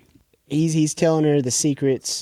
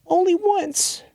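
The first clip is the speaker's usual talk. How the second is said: small, much higher voice; high-pitched girl's reply; slower than usual. Retold or imitated. Girl answering a question about the mask, high-pitched imitation.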